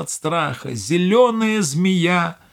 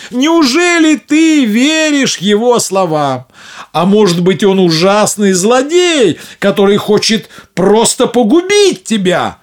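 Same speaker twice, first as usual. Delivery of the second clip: alarmed mother; 7 semitones higher, 8 dB louder than usual. Acted. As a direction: warn her urgently of danger. Mother warns daughter.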